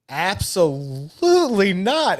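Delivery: drawn-out, adamant retold veto; emphatic refusal. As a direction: stretch it out as an imitated firm no. Wife's firm refusal, retold.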